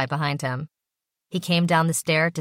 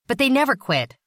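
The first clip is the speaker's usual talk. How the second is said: higher, louder irritated outburst; irritated exclamation; 8 semitones higher, 4 dB louder than usual. A narrator's reading. Mister Satari to Basil, arguing about addicts, irritated and higher. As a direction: exclaim it, openly annoyed. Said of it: "exclaimed, obviously irritated".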